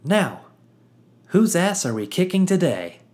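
bright, high, bursting with joy; beaming with joy. Acted. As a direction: bounce in, thrilled to be here. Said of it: "hopped up and down in excitement".

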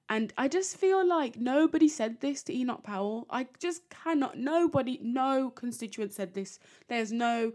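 high, loud disbelief; incredulous protest. Real, not acted. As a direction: protest loudly, pitch jumping up. Flatly disbelieves Powell's story.

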